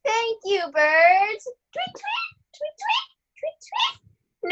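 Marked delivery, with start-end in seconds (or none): 1.7-4.5 high squeaky tweeting like a little bird; bird tweets. Acted. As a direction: chirp it out high and thin. Cinderella imitates the birds.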